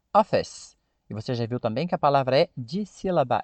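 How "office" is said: "office" as two syllables, no added final i; English pronunciation. Shown as a demonstration. Teacher to learners, repeating the model "office". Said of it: "você já viu o código fonético lá".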